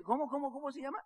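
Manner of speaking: high, hushed, repeated question; anxious asking. Retold or imitated. César presses during brother's phone call, anxious. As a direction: press for it softly, pitch climbing. Anxiously waiting for company name.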